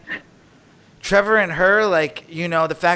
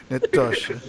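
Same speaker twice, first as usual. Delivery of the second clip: put-on Russian accent; 3 semitones lower than usual. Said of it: "Natasha in a Russian accent".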